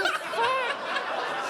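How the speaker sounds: slow, high, laughing what the fuck; laughing disbelief. Retold or imitated. Sitting in the car with the cop, laughing shocked squeal.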